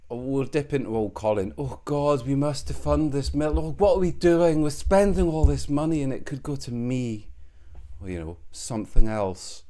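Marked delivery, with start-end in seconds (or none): 0.1-7.3 mocking whine. Mocking what the listener thinks.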